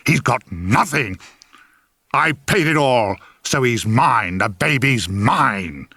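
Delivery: slow, cold, deliberate contempt. Acted. Grandfather eyeing the baby's father, slow menacing contempt. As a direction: lean on each word, eyes on the father. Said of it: "as he eyed me".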